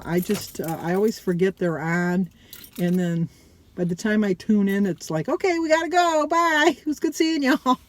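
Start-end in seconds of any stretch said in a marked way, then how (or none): 5.3-7.8 high-pitched, cheery mock sign-off; streamer signing off. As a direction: imitate a streamer's sing-out goodbye with a laugh.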